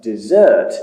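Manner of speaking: "desert" stressed on the second syllable. Shown as a demonstration. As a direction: say desert as the verb, de-ZERT. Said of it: "is a verb meaning to leave or abandon".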